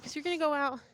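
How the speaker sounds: normal talking voice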